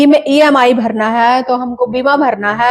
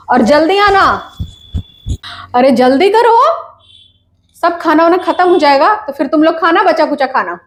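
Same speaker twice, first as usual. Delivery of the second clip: higher-pitched hurrying call; calling out impatiently; 5 semitones higher than usual. Acted. Mother hurrying children to the party, impatient calling.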